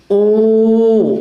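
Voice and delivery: "ओ" held long on a level pitch; drawn-out vowel. Shown as a demonstration. Teacher splitting go into its sounds, "ओ" held very long. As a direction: stretch "ओ" into one long steady vowel. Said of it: "इसको आप translate करोगे तो देखो".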